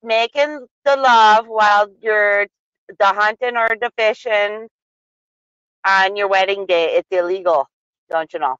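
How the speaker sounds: Wisconsin accent. Shown as a demonstration. Repeating the odd law for a baffled co-host, Wisconsin accent. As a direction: spell it out in a broad Wisconsin accent. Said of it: "with the Wisconsin accent if you want".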